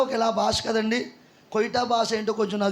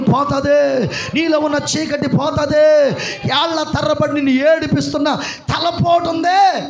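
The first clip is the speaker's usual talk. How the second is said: fiery preaching; 2 semitones higher, 6 dB louder than usual. Real, not acted. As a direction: thunder it over the crowd. Preacher at full force.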